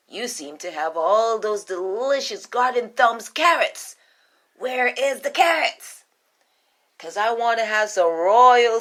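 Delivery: queen demanding carrots from the rabbit, loud and grand. Acted. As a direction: savor every royal word.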